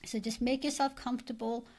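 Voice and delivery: normal talking voice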